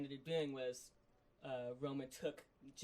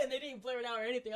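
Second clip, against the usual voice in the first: hyped; 10 semitones higher, 7 dB louder, faster than usual. Real, not acted. Still hyped about uncensored promo.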